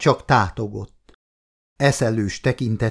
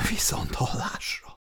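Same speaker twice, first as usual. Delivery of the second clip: stunned, voiceless whisper; 2 semitones higher, 9 dB quieter than usual. A narrator's reading. Woman ending the call about her husband's death, whispered. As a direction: whisper the goodbye, barely voiced. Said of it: "suttogta Anita".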